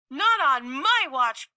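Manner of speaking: defiant retort. Acted. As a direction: stand up to the bear. Squirrel defies bear.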